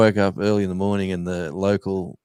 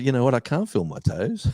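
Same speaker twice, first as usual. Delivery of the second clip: laughing through a retold thought, a bit higher; 6 semitones higher than usual. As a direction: chuckle as you admit the toes are numb. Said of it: "I thought".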